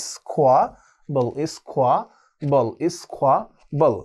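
স্কোয়াবল stretched out slowly; slow drilled pronunciation. Shown as a demonstration. Teacher repeating the word aloud for students, slow and clear. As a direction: say স্কোয়াবল slowly so it can be copied. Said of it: "মুখে মুখে উচ্চারণ করে".

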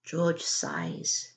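ordinary delivery, nothing marked

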